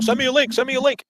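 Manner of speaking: high, pleading mimicry of a beggar; mocking whiny beggar. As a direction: whine it like you're begging for a favor. Mocking Aiden begging for link.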